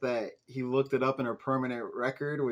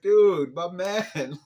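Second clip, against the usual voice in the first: exasperated; 4 semitones higher, 6 dB louder, much slower than usual. Real, not acted. Exasperated call to a character.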